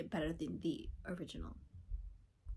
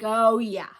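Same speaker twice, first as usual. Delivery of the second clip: loud thrilled; 15 dB louder than usual. Retold or imitated. Reacting to seeing the edit.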